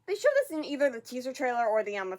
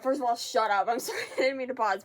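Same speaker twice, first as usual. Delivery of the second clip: ordinary delivery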